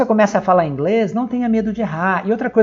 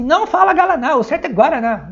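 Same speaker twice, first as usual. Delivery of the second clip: mock correcting mother; 7 semitones higher than usual. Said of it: "nenhuma mãe falar assim".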